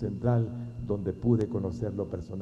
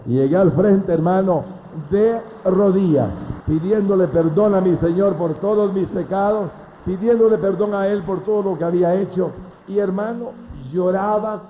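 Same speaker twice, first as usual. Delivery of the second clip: fervent preaching; 8 semitones higher, 8 dB louder than usual. Acted. Preacher testifying to his brothers, loud fervent cry. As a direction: proclaim it to the congregation.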